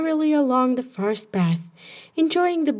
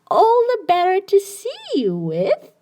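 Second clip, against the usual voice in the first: higher, louder squeaky wolf voice; 9 semitones higher, 4 dB louder than usual. Acted. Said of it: "squeaked the wolf".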